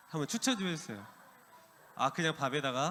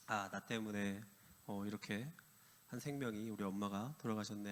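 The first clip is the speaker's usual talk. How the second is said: soft, hushed lament of guilt; grieving murmur; 5 semitones lower, 10 dB quieter than usual. Retold or imitated. Girl on the rooftop blaming herself, soft grief. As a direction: murmur it as if the words hurt. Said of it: "하면서 슬퍼하면서".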